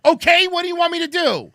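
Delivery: high, loud exasperated question. Retold or imitated. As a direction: throw it back at him in disbelief. Exasperated quote of himself, high.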